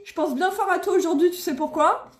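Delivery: usual speaking voice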